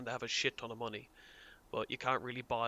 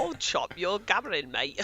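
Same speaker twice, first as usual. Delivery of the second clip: higher and louder hammed-up Cockney voice; mock Cockney; 6 semitones higher, 8 dB louder than usual. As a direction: jeer at him in an over-the-top Cockney accent. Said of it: "Cockney lad".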